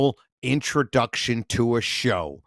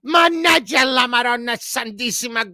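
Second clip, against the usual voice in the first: screaming Italian mother; 10 semitones higher than usual. Retold or imitated. Son imitating his mother's screaming, high Italian yell. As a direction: scream it like an angry Italian mom. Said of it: "screaming and yelling at the top of their lungs".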